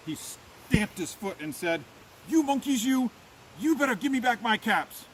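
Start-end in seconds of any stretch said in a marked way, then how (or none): 2.3-5.0 raised, higher-pitched scolding; quite angry peddler. A narrator's reading. Peddler stamping his foot at monkeys, angry scolding. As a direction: demand it crossly, pitch up. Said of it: "Now he felt quite angry".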